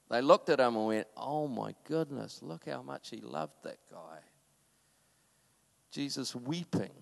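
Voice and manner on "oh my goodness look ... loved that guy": hushed awe. Retold or imitated. Onlookers' hushed awe, quoted.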